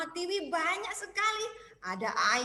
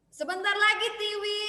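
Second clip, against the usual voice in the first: mom answering; 7 semitones higher, 6 dB louder than usual. Acted. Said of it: "dia bilang".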